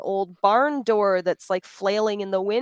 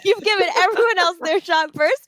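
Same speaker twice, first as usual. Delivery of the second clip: laughing through the words, much higher and louder; giggling while teasing; 9 semitones higher, 5 dB louder than usual. Real, not acted. Teasing a polite colleague, laughing.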